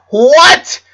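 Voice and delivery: sudden high shout of disbelief; stunned loud cry; shocked shout. A narrator's reading. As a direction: yell it, jumping up in shock. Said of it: "he shouted, standing up".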